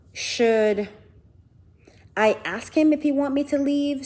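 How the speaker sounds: ordinary delivery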